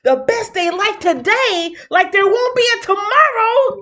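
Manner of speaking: reading that climbs to a high shout; building hype. Acted. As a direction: build to a loud, soaring finish.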